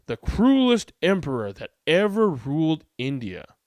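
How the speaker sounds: ordinary delivery, nothing marked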